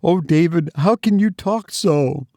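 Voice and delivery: high, tearful mother's plea; understated sob in a high voice; mildly sobbing. A narrator's reading. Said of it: "sobbed her mother".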